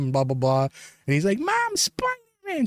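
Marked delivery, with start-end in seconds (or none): 1.4-2.7 put-on very high, slow child calling out; little kid voice. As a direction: call to mom like an awed toddler.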